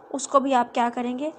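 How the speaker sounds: normal talking voice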